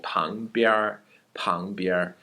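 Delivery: 旁边儿 said with a curled r ending; 旁边儿 with the final syllable rolled into an r sound; with erhua. Shown as a demonstration. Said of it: "For example".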